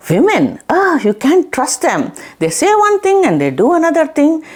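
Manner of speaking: exasperated king, lightly acted; retold grumble of mock anger; mildly angry. King fed up with the deceitful lady snake, mildly angry. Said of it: "he is so angry at this lady snake".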